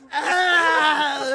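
loud, long, high "aah" with tongue out; tongue-out yell. Retold or imitated. Imitating the comic's pussy-eating act, loud tongue-out yell. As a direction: mimic a lewd tongue-waggling holler. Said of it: "he does it again".

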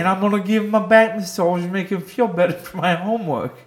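high put-on European accent; bad European accent, proud; fake European accent. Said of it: "terrible, like, South African accent".

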